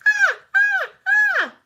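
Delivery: repeated high, loud screams. Acted. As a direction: scream again and again to scare. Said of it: "I screamed".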